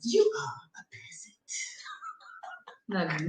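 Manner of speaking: high, whispery mock British accent. Co-host performs the peasant line, hushed mock British accent. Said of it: "say it in a British accent".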